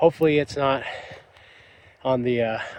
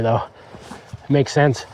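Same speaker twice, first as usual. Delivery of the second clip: ordinary talk, nothing marked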